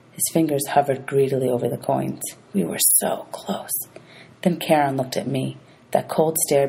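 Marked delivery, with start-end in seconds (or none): 2.5-3.7 hushed, slow whisper; whispered narration. A narrator's reading. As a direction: breathe it like a held secret. Narrator tense aside.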